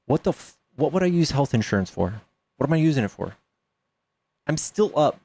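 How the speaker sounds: ordinary delivery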